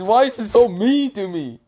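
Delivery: high, loud anime whine; mock tearful wail. Naruto lamenting Boruto's insults, mock tearful. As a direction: wail it like a hammed-up cartoon sulk. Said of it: "he's just having these anime tears".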